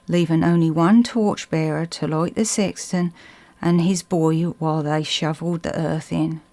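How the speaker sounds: usual speaking voice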